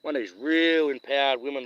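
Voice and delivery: ordinary delivery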